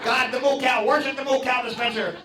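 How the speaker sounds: very high, frantic preacher rant; high-pitched zealot shouting visions; religious nut raving. Retold or imitated. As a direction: rave about Satan in the syrup. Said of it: "the religious guys running around with a Bible".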